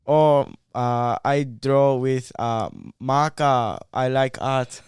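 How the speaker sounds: put-on slow, halting Japanese accent; broken English Japanese accent. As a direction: fumble for simple words. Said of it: "speaking in, like, broken English".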